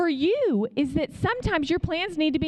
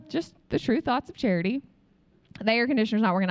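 ordinary talk, nothing marked

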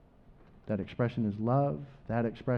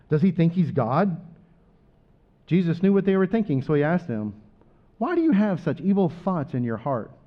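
dramatic gospel reading; 3 semitones higher than usual. Acted. Pastor reads Matthew to the church, raised and fervent. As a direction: tell Jesus's story to the church with fervour.

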